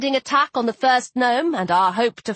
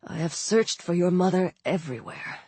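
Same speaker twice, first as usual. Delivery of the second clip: low, soft, dejected voice; subdued sorrowful father; despondent; 4 semitones lower, 5 dB quieter than usual. A narrator's reading. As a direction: confess it quietly, without hope. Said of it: "Dad said despondently".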